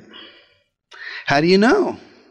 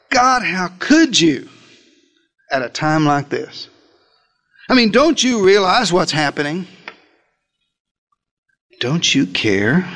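complaining to God. Pretend. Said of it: "you said to God".

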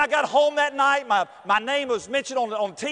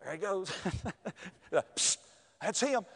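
whispered gossip; 3 semitones lower, 9 dB quieter, slower than usual. Retold or imitated. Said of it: "it was like this".

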